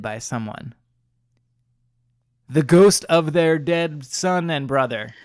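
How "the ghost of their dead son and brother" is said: slow, loud ghost-story narration; spooky reveal. A narrator's reading. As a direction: announce the ghost with drama.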